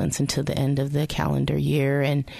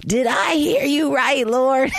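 high, loud, laughing disbelief; laughing in disbelief at God's call; incredulous; 11 semitones higher, 5 dB louder than usual. Retold or imitated. Woman asking God if she heard right, laughing disbelief. Stunned at being called away.

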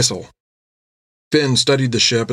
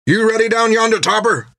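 calling down; 11 semitones higher than usual. Acted. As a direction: call down below deck. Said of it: "called down".

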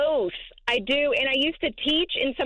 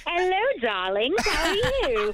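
put-on British accent; 6 semitones higher than usual. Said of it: "I do it all the time".

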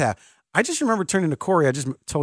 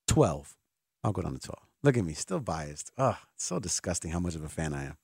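quiet self-mocking aside; 10 semitones lower, 9 dB quieter than usual. Real, not acted. Self-mocking aside about his bias.

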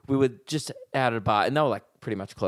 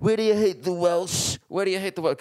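higher-pitched, badly faked Welsh accent; attempted Welsh accent; 7 semitones higher than usual. Comic mimicking the drunk girl's complaint, attempted Welsh accent. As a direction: imitate an affronted Welsh woman's accent, pitched up. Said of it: "I'm not, can't do accent".

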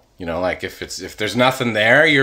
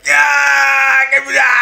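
greedy plant shout; 13 semitones higher, 5 dB louder than usual. Pretend. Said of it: "and it's like".

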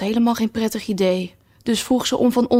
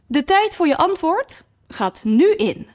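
high, clipped announcement; strict queen; 8 semitones higher than usual. Acted. Red queen tells Alice her time starts now, high and strict. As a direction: start the clock like a stern queen.

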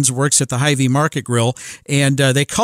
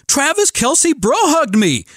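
put-on much higher, slow, awed kid voice; thrilled son; 11 semitones higher, slower than usual. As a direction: savour it like a starstruck boy.

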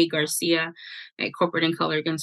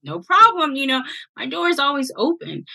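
breezy reassurance; 7 semitones higher than usual. Retold or imitated. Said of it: "And I'm like".

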